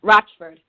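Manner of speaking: "Rochford" said as Rotch-ford, loud; ch pronunciation. Shown as a demonstration. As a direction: say "Rochford" firmly with a tch sound. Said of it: "R O T C H".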